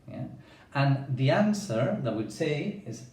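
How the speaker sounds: normal talking voice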